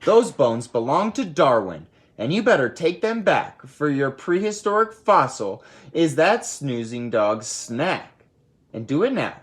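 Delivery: stern mother ordering her son. Acted. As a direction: lay down the law to the kid. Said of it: "ordered Mama".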